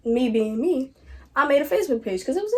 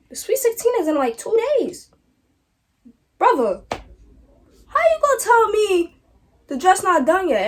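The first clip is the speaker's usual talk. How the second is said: pitched way up in disbelief; Brother, how you gonna tell me; outraged disbelief; 8 semitones higher than usual. Retold or imitated. Outraged retelling to the tailor.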